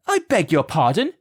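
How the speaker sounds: a mother stopping him cold; indignant mum. A narrator's reading. Mum calls across the road at Gawk, indignant. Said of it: "came Mum's voice".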